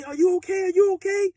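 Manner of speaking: very high, loud panicked asking; frantic girlfriend. Retold or imitated. Girlfriend at the visit frantic over him, very high. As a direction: panic for someone you love. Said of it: "Now she frantic".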